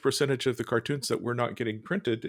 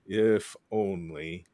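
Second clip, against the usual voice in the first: slow, lower seagull voice; poor seagull; 6 semitones lower, much slower than usual. Retold or imitated. Seagull in plastic ocean answering the guy, slow, lower voice. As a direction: let the seagull sigh it out slowly. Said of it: "the poor seagull says".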